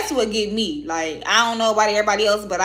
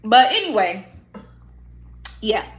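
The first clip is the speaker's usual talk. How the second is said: ordinary talk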